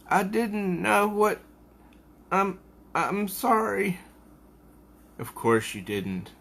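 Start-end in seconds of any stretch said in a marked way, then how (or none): 0.1-4.0 high, tearful apology; starting to cry. Acted. Coco apologising for her mother, tearfully. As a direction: apologise on the edge of crying. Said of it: "now she's starting to cry".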